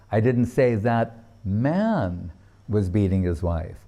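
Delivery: single out "man"; stress on MAN. Shown as a demonstration. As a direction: lean on "man". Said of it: "According to where the emphasis is placed".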